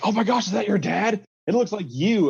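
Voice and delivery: light mock student gush; imitating students. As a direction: gush like a teenager who just noticed. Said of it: "they're like".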